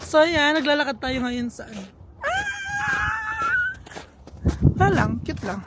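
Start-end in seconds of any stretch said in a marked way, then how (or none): 2.2-3.5 sudden high cry. Real, not acted. Sudden cry while walking.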